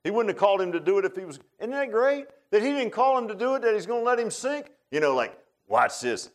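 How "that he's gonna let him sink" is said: ordinary delivery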